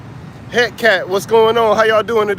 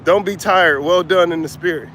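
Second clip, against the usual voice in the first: normal talking voice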